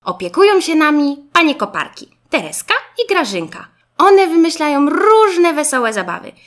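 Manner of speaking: put-on enthusiastic young classmate. A narrator's reading. Garbusek showing Zygzak the playroom, enthusiastic. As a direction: show off every treat with delight.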